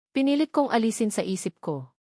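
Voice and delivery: ordinary delivery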